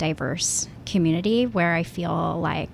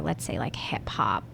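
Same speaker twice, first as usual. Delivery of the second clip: whispering; 8 semitones lower, 7 dB quieter than usual. Real, not acted. Unsure comparison, voice dropping away.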